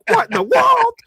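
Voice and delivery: very high, loud shriek of disbelief; re-enacted fan freak-out. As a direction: squeal it in total astonishment. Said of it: "freaking out".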